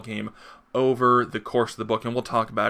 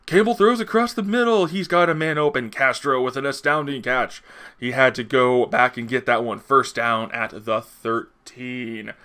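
sports announcer voice; 4 dB louder than usual. Shown as a demonstration. Comic book reviewer doing a sports announcer call. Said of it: "there's my sports announcer voice for ya".

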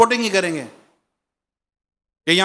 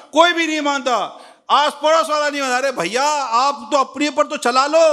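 higher, pleading preacher's voice, building through the list; a rising call to drop each sin; impassioned preaching; 6 semitones higher than usual. Acted. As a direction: press each demand harder, like a sermon climax. Preaching at full force.

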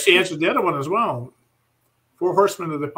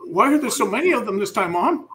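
put-on higher-pitched child's questioning; kid; 6 semitones higher, 4 dB louder than usual. Son questioning his mother about them, kid voice. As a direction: ask it like a puzzled kid, pitched up. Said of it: "trying to look like a kid".

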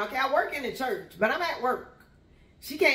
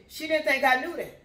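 normal talking voice

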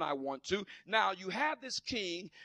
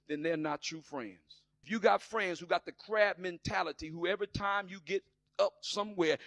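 ordinary talk

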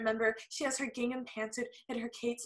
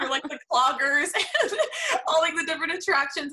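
laughing; 5 semitones higher, 8 dB louder than usual. Retold or imitated. Continues excited quote, laughing.

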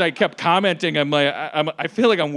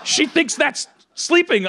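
raised, high mock indignation; imitated outraged parents; 9 semitones higher than usual. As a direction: scold the uncle as an appalled parent. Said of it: "her parents will be like".

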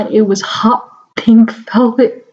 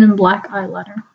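ordinary delivery, nothing marked

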